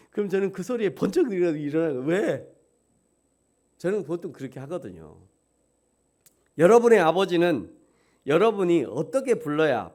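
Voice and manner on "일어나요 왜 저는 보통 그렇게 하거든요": normal talking voice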